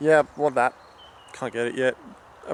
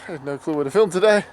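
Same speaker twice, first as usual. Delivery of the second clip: ordinary delivery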